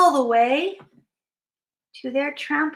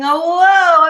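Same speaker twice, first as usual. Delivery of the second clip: loud, raised, swelling whoa; amazed; 4 semitones higher, 9 dB louder than usual. Acted. Friends watch the Yeti's trick, loud amazement.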